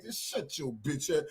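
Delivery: low, soft threat; Angelo's menacing voice. Retold or imitated.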